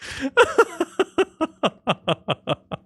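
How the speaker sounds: high, rattling giggle fit; high helpless laughter. Real, not acted. Streamer cracking up at his own impression, helpless laugh. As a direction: crack up completely.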